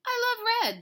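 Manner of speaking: high, loud storybook exclamation; excited kitten. Acted. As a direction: blurt it out with childlike delight. Storybook kitten exclaiming his love.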